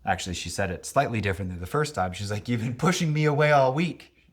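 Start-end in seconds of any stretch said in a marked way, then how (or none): 2.5-4.3 higher, louder mock-grumpy partner; hammed-up sulky partner; partner in a mood. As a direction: imitate her sulky accusation. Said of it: "in her mood".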